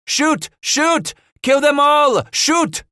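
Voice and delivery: very high, loud yells in short bursts; frantic shouted command. A narrator's reading. Paul leaps up under fire, screaming orders to his men.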